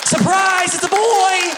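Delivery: hammed-up proud parent; mock announcement. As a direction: squeal it like a gender reveal.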